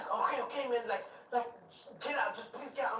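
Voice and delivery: high, nervous driver begging him out; lightly voiced panicky driver; scared cab driver. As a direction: play the frightened driver.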